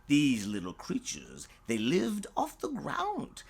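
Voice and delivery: usual speaking voice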